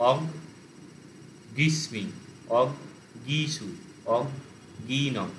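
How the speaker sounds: "অগ", pause, then "গিস্মি"; broken into syllables. Shown as a demonstration. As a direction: clear gap after every "অগ" before its ending. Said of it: "ওটাকেও syllable ভেঙে নিতে হবে". ("অগ ... গিসু" syllable ভেঙে)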